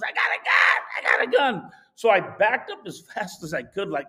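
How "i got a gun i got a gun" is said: loud, strained imitated yell; mock screaming. Old man waving pistol at couple, mock screaming. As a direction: mimic his top-of-the-lungs threat, loud. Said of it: "screaming at the top of his lungs".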